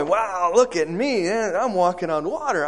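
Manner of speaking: high, loud, exultant boasting; acted joy. Peter walking on water shows off, acted overjoyed cry. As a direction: crow with delight, stretching the wow. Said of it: "must have been filled with much joy".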